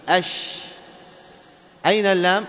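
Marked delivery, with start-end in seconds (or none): 0.1-0.5 "أش" cut off after a held sh; lam merged into shin. Shown as a demonstration. Teacher showing where the lam went, isolated "أش". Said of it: "في الشين، فتقول".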